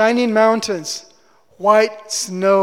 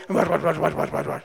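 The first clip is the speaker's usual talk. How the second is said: low, muttered mock-barbarian babble; imitating barbarian speech; 6 semitones lower, 5 dB quieter, faster than usual. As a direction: mimic rough foreign talk as low, softening gibberish. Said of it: "It sounds to me like".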